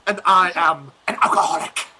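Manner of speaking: laughing through a fake name. Guest doing a joke meeting intro, laughing. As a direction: crack up mid-introduction.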